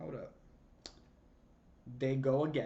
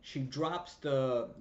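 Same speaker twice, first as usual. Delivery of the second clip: ordinary talk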